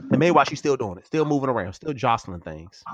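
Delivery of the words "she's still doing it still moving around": ordinary delivery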